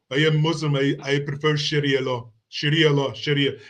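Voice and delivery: lightly put-on foreign accent, halting; half-hearted mimicry of a Somali immigrant's accent; a hint of a foreign accent, mocking. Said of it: "They, they didn't even sound like Americans".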